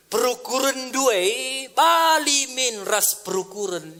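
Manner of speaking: slow scripture reading. Acted. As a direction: intone the Karo verse slowly. Reads verse in Karo.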